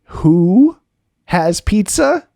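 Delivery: high, loud bewildered Who has pizza; baffled and stretched out; confused surprise. Retold or imitated. Roommate confused by a friend's Cajun phrasing, bewildered. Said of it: "And I said".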